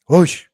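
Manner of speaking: a loud, swooping whoosh; whoosh sound. Retold or imitated. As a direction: sound out a steep crash on the chart. Showing a chart's steep drop.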